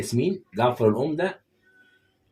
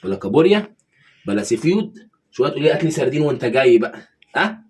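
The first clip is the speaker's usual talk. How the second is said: loud sarcastic ranting, voice climbing; 3 semitones higher, 8 dB louder than usual. Acted. Husband to wife over her suhoor demands, loud mocking rant. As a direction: scoff loudly at every fancy dish.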